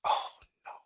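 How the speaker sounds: breathless whispered oh no; whispered dismay. Retold or imitated. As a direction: let it slip out under your breath. Reacting to head shot news.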